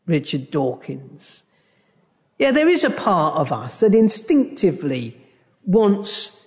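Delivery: ordinary talk, nothing marked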